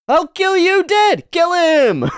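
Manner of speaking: high, loud screamed threats; berserk rage. Acted. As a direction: shriek the kill order. Berserk shouting.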